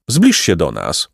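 ordinary delivery, nothing marked

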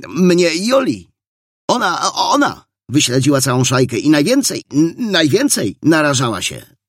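high, excited gushing with stumbles; joyful emotion. A narrator's reading. Boy credits Jola before the officer, joyfully moved. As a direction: gush it out, overcome with joy. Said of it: "wyrąbał ogarnięty radosnym wzruszeniem".

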